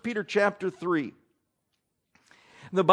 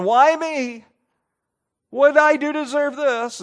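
mock whining; 7 semitones higher, 5 dB louder than usual. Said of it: "moaning and groaning and belly aching".

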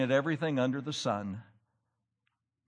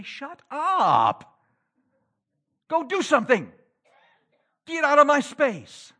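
higher, louder mock snap at busybodies; imitated angry outburst; 11 semitones higher, 6 dB louder than usual. Tired worker imagining telling meddlers off, mock angry shout. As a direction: act out wanting to tell the nosy ones off. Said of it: "And you just want to say".

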